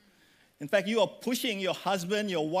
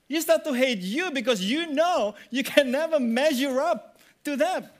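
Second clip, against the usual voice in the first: mock self-pity; 6 semitones higher than usual. Preacher voicing self-loathing.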